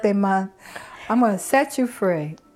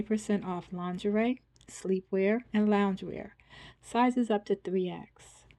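ad read; 8 dB quieter than usual. Acted. Outro and ad read.

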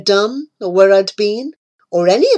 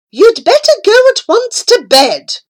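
high, loud order to bed; stern mother; 11 semitones higher, 6 dB louder than usual. A narrator's reading. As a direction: send the child off sharply. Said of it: "my mother said".

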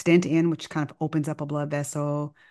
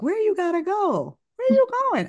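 much higher, pleading questions; incredulous; 3 dB louder than usual. Retold or imitated. Doctor asking the patient where he's rushing off to, high and pleading. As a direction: voice shoots up in disbelief.

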